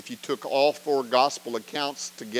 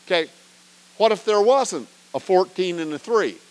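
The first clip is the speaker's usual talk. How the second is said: usual speaking voice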